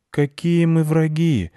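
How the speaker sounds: high, drawn-out questioning protest; protesting cat. A narrator's reading. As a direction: question it innocently, stretching it. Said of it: "спросил кот".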